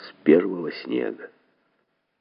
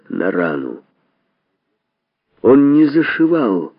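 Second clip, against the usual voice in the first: ordinary delivery, nothing marked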